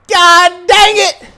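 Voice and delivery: loud, high, drawn-out shout; Bucky cursing. Acted. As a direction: yell the curse slowly in full frustration. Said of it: "He just sits up and he goes".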